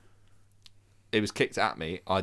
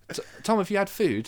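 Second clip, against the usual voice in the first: lightly voiced Irish nan, higher pitch; a hint of a kindly nan, pitched up; soft-spoken Irish nan; 11 semitones higher than usual. Nan asking her grandson if he's eaten, lightly voiced. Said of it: "No, she'd be like".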